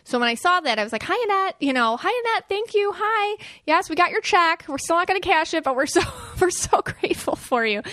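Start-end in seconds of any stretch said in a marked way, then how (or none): 1.0-1.6 high, sing-song hello to a spirit; bright greeting. Retold or imitated. The speaker greets her husband's late cousin, high and delighted. 2.0-7.8 high, gushing thank-you to a spirit. Retold or imitated. The speaker thanks her husband's late cousin for the check, high and gushing.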